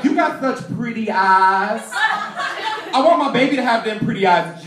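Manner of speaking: slow, drawn-out admiring impression; imitated admirer. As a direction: play a gushing admirer, words stretched out. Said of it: "come up to me and be like".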